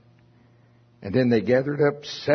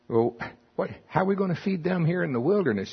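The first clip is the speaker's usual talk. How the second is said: disbelieving disciples; 7 semitones higher, faster than usual. Retold or imitated. Disciples' panicked question voiced.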